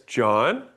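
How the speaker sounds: "John" said with a questioning rise at the end; rising tune on John. Shown as a demonstration. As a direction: ask "John" with a lifting tone. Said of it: "I do that because".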